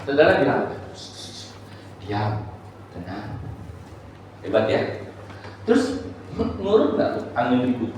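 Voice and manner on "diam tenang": low, very soft command; quiet words, spaced by a pause; gentle hushing. Shown as a demonstration. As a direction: hush a small child calmly. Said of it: "Kan enggak, kan? Saudara bilang".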